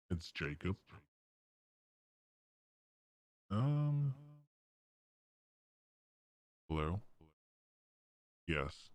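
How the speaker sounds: metallic megaphone voice changer; robotic voice. Real, not acted. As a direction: speak through a robotic megaphone filter. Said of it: "it sounds less robotic".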